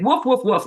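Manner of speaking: dog barking. Pretend. Dog barking at meth heads.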